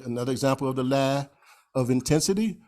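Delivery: ordinary talk, nothing marked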